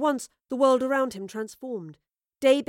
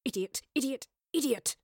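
growled at herself; 4 semitones higher than usual. A narrator's reading. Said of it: "she growled at herself".

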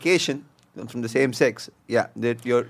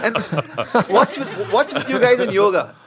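laughing; 7 semitones higher, slower than usual. Real, not acted. Laughing high question.

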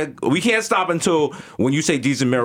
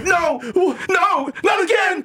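high desperate wail; slower than usual. Retold or imitated. Mister Peanut wakes to a new shell, high despairing cry. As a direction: beg in a high shout, horrified. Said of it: "He's like".

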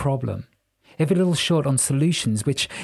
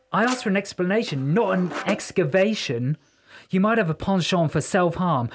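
higher-pitched, fussy complaint; affronted musician; 5 semitones higher than usual. Acted. Archie scolds Jessica for hurting him, affronted. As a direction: protest with wounded dignity.

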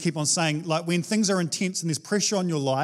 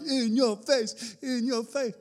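gloating taunt; 6 semitones higher, 3 dB quieter, slower than usual. Retold or imitated. Elijah gloating over his victory.

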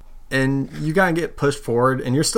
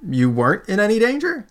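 questioning; 9 semitones higher than usual. Acted. Halfling questions player before rival.

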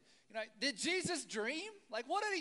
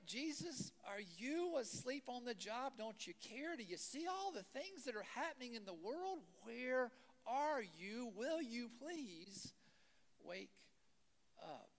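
soft pleading prayer; 6 dB quieter than usual. Retold or imitated. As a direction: plead quietly with a sleeping God. Believer pleading with absent God.

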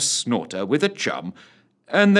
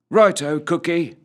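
higher-pitched agreeable reply; soldier's reply; 7 semitones higher, 4 dB louder than usual. A narrator's reading. Trout agreeing to join the cook's chores, in character. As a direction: answer up high, starting loud.

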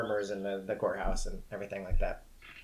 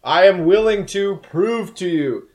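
high, loud, stately proclamation; booming cat voice; 7 semitones higher, 12 dB louder than usual. Acted. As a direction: boom it out like a cat addressing the whole court. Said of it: "is this booming sort of".

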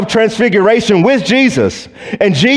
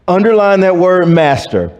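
ordinary talk, nothing marked

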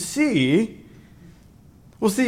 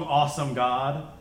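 usual speaking voice

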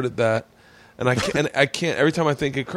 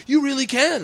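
very high, hammed-up girlfriend voice; imitated gushing encouragement; 12 semitones higher than usual. Girlfriend encouraging budding comic, imitated gushing cheer. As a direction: cheer him on in a high mock voice.

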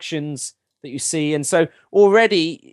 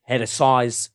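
Michael Caine impression; 3 semitones lower than usual. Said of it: "Michael Caine".

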